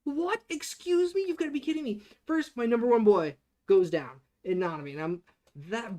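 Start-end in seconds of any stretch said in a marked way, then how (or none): 0.0-2.0 high, stunned "what? excuse me?"; reliving the shock of a favorite dying, aloud; shocked disbelief. Retold or imitated. Fan recalls a favorite dying, shocked disbelief. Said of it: "I'm like".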